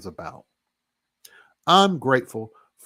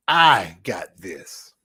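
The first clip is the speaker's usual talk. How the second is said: slow, lower, louder and solemn; slow solemn God voice; 4 semitones lower, 5 dB louder, much slower than usual. Retold or imitated. A man quoting God's promise to viewers, slow and solemn. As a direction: intone it like a divine promise. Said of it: "That's what he said to me".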